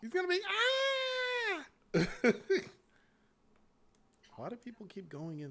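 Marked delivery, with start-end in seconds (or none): 0.0-1.9 mock scream, much higher and louder; high squeal of a mock scream; high-pitched scream. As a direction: shriek like a gamer losing. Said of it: "screaming in that high-pitched voice".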